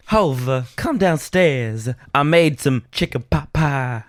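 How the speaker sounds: high, slow Southern diva; hammed-up biscuit-and-gravy voice. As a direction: call your husband to dinner with stretched vowels. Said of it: "my [name] impersonation".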